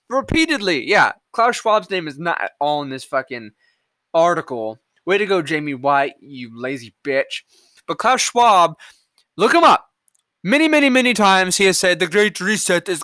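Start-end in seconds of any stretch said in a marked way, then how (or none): none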